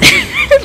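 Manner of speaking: high-pitched burst of laughter. Real, not acted. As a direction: shriek with laughter.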